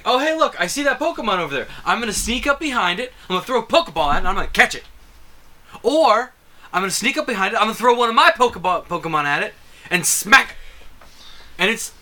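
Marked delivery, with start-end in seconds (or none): 0.0-10.6 excited gamer. Retold or imitated. Acting out playing the game.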